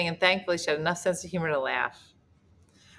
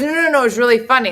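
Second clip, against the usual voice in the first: fast, loud, high mock protest; hurried backtracking; 7 semitones higher, 10 dB louder, faster than usual. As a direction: imitate someone rushing to take it back. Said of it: "He goes".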